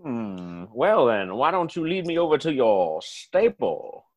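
put-on low southern gentleman accent; genteel southern accent. Southerner asking to see the stable, genteel southern accent. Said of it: "genteel way that you guys talk".

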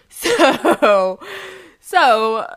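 loud laugh through a drawn-out word; real laughter spilling into the word. Real, not acted. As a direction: crack up while trying to move on. Laughs after insulting her mom.

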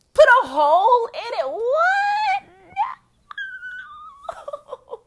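very high, loud squeal of disbelief; incredulous high-pitched outburst; outraged disbelief. Real, not acted. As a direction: squeal it out, aghast. Outraged disbelief at piercing idea.

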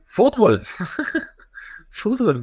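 high laughing fútbol; laughing exclamation. Real, not acted. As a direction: blurt it out, amused at his own answer. Laughing answer about Bolivia.

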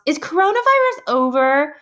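high, loud mock outburst; retold shout. Student interrupts story time, high mock shout. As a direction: blurt it out like a kid interrupting. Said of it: "somebody shouts".

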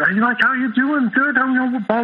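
imitating a chatty, high-pitched hello; high, sociable patter of an outgoing pal; gregarious friend. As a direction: rattle off mock small talk, pitched high. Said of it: "he's very gregarious".

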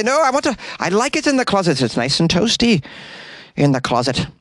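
high, playful mock-Irish protest; butchered Irish accent. Jonesy refusing to leave his cosy closet, butchered Irish accent. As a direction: refuse in a hammed-up Irish lilt. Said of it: "I'm the king of butchering accents".

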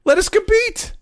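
very high falsetto plea, rising at the end; squeaky pleading voice. Retold or imitated. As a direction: beg in a tiny squeaky voice. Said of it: "He said it like that".

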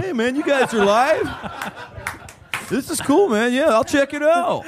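high put-on voice of the local legend; Steve. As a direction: mimic a laid-back caller, pitched right up. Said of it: "Steve is a legend in Charleston".

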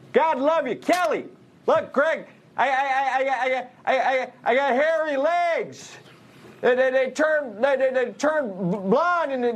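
high, stammering elderly politician impression; Joe Biden. Comedian doing a president for a talk show host, stammering impression. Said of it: "you do a pretty good Biden impression".

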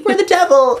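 very high mock socialite voice. Imitating the pop singer on stage, high ditzy voice. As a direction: put on an airhead starlet squeal. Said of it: "She was [name]".

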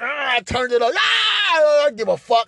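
high whooping cries; gleeful shrieks. Retold or imitated. Turning the dial up, gleeful high whoops. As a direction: whoop with glee. Cranking it with glee.

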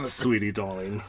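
low mock-British endearments; British accent. Podcast host cracking up co-host with mock British accent. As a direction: drop low into a put-on British voice. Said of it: "my British accent".

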